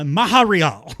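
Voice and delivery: Maharial, loud, with an extra breathy-H syllable for Montreal; botched French pronunciation. Retold or imitated. Mocking a wrestler's attempt, Maharial loudly mispronounced for Montreal. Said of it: "it sounded like, uh, somewhere in the Middle East".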